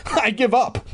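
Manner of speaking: ordinary delivery, nothing marked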